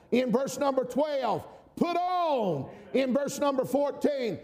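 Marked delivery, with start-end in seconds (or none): 1.7-2.7 high, ringing "Put on."; emphatic command. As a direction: hit "Put on." hard and high. Preacher repeats scripture command.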